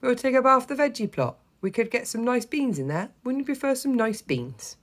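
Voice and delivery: put-on high, persuasive old man; coaxing grandad. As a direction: coax him toward the beans.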